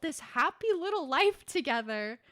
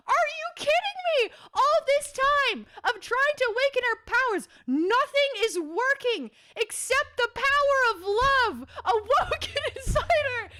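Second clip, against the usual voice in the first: much higher and louder, gushing fast; excited squealing; 11 semitones higher, 6 dB louder than usual. Real, not acted. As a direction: squeal it like a fan who can't believe it. Fangirling over power of love.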